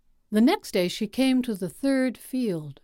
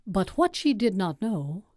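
ordinary delivery, nothing marked

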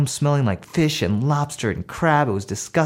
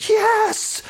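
very high, drawn-out yes; defiant little kid. Acted. Little brother admits it to his mom, high defiant squeal.

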